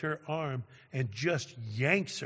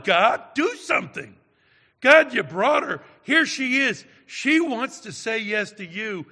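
loud, much higher pleading yell; desperate scream to God; 9 semitones higher, 10 dB louder than usual. Retold or imitated. Preacher silently screaming to God for help, desperate loud plea. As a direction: beg God at the top of your lungs to act. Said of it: "screaming to God".

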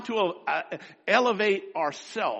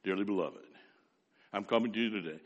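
Michael Brown impression; 10 semitones lower, 9 dB quieter, much faster than usual. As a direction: imitate a smooth old preacher greeting his flock, low and soft. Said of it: "He talks like this".

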